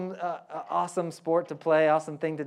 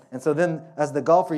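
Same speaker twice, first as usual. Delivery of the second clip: ordinary delivery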